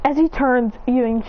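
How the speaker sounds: plain reading voice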